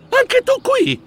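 high surprised question; stunned to see her there; startled. Acted. Mouse meeting neighbour Rebella, high surprised question.

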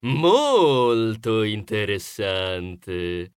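very slow, low, stretched-out scheming drawl; low, lingering interest; intrigued. A narrator's reading. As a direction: savour the idea, dragging the first word. Neighbour takes interest in contest.

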